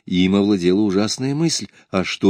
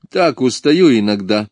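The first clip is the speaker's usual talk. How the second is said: ordinary delivery, nothing marked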